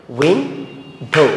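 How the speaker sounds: "Window" as two separate beats, উইন and ডো; split into syllables. Shown as a demonstration. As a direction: say "window" slowly with a gap before ডো. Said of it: "This is how you have to practice".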